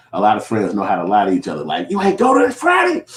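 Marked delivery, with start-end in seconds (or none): 1.9-3.1 high, squeaky put-on voice of a bragging friend; mocking friend. Friend bragging about the party you missed, high squeaky mock voice. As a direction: tease in a high cartoon voice.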